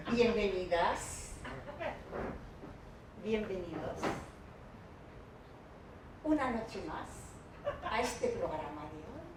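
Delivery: spoof a late-night show, long pauses, fading soft; sultry late-night host. Pretend. Parody late-night sex show opening.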